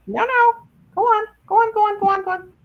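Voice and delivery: high-pitched "go on" to the dog; shooing the dog. Real, not acted.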